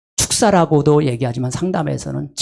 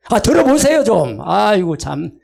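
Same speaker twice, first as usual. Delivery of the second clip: high, loud exasperated plea; loud plea to listen; 4 semitones higher than usual. Shown as a demonstration. A counselor demanding the spouse listen, loudly exasperated. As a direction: raise your voice to be heard. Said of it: "이렇게 제가 크게 소리 지릅니다".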